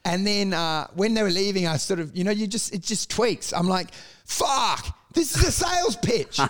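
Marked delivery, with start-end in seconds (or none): 4.3-6.4 high-pitched re-enacted exclamation; sudden realisation. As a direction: act out the penny dropping, pitch shooting up. Acts out his sudden realisation.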